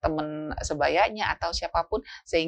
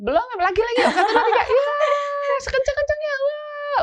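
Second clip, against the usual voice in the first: very high, loud laughing yell; high shrieking countdown to a scream; excited shouting; 5 dB louder than usual. Retold or imitated. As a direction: shout at full force like a thrilled kid. Child demanding more shouting.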